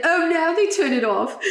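high, laughing attempt at an Australian accent; badly faked Australian accent, annoyed and amused; botched Australian accent. Retelling Oscar annoyed at the TV, failed Australian accent. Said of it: "That was not an Australian accent".

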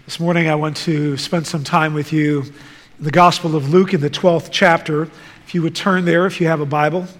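ordinary talk, nothing marked

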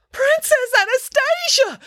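very high, drawn-out theatrical wail. A narrator's reading. Older woman sees empty cat stroller, wailing. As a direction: wail the name like a hammed-up distraught owner. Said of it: "wailed a buxom".

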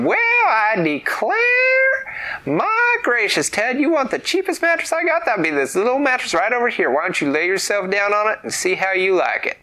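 very high, loud southern exclaim; high excited country voice. Acted. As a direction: squeal it like a giddy shopkeeper. Root and Rod exclaim.

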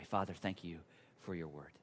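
low, soft, hushed prayer; closing prayer. Real, not acted. Pastor leading the congregation in closing prayer, low and reverent. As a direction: pray quietly with long pauses.

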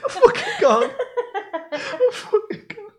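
wheezing, squeaky words through laughter; very high, laughed-out words; helpless laughter. Real, not acted. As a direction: give up, dying laughing. Cannot repeat it for laughing.

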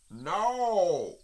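high, drawn-out no; Rabbit calling from inside. A narrator's reading. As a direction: answer from down a hole. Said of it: "said a loud voice".

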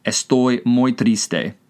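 slow, evenly spaced words; careful, drawn-out demonstration; slow language-teacher model. Shown as a demonstration. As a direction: say it slowly for learners to repeat. Teacher repeats phrase to translate.

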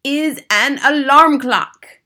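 slow, grand witch's reveal; slow triumphant proclamation; triumphant announcement. Acted. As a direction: unveil it proudly, drawing out each word. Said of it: "announced the Grand High Witch triumphantly".

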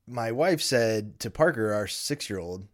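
usual speaking voice

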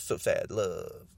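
slow, low mocking sign-off; lightly voiced Trump quip; imitating Trump. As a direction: drawl it like a put-down. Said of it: "then he says".

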